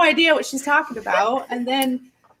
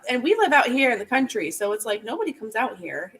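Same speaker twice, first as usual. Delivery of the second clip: ordinary talk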